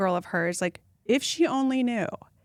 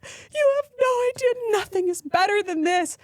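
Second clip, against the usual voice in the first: crying with joy; 4 dB louder than usual. Retold or imitated. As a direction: wail it out like happy sobbing over the pigs. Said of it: "I burst into tears".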